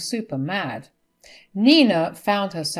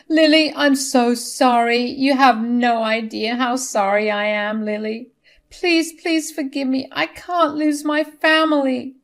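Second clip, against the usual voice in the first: high, pleading mother-in-law apology; tearful apology; 6 semitones higher, 4 dB louder than usual. Acted. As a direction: apologise in tears to your daughter-in-law. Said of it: "She tearfully said".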